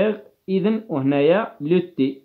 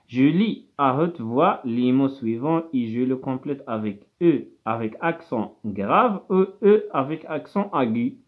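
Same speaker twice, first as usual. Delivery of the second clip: slow, careful classroom dictation; deliberate teacher reading pace; much slower than usual. Shown as a demonstration. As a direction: read the instructions slowly, word by word. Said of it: "teacher reading exercise instructions aloud".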